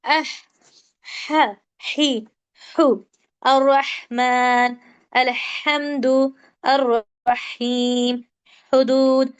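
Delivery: slow, separated syllables with a strong throat ह in अलहम्दु; throat ह practice. Shown as a demonstration. Teacher models syllables for students to practise, slow deep ह as in हुदूद. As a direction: draw out each ह from the throat, अर्रहीम very slow. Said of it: "practice कीजिए।".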